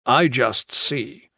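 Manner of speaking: very slow, heavy words; slow and deliberate. Acted. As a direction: let each word land slowly. Final calm statement.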